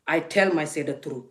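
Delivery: usual speaking voice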